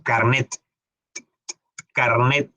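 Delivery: "carnet" with the last t released; sounded final T. Shown as a demonstration. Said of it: "Ahí estamos haciendo el sonido".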